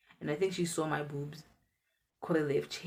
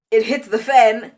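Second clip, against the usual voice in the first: dramatic emphasis; 5 semitones higher, 15 dB louder than usual. Retold or imitated.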